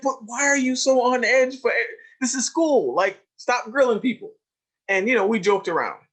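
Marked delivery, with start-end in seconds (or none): 0.0-4.3 high, bright-pitched joking scold; lively mock scolding; playful high teasing. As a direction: tease him loudly and high. Joking with student.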